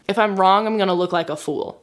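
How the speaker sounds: normal talking voice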